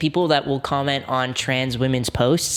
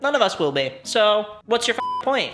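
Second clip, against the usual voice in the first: ordinary talk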